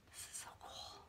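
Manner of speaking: breathy little whispered aside. Real, not acted. Whispering in delight to viewers over comments. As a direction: whisper it as if sharing a secret.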